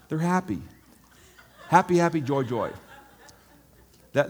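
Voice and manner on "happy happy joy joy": loud, high, laughing glee; gleeful laughing. Acted. Preacher voices carefree birds, loud laughing glee. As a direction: burst out laughing with joy.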